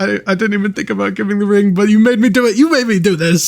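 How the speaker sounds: high, mock-aggrieved protest; playful accusing. Real, not acted. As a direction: blame your partner in mock outrage. Playful blame for the scene.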